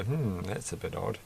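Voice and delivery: quietly puzzled aside; a hint of mild bemusement; mild puzzlement. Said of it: "it takes a lot for me to go".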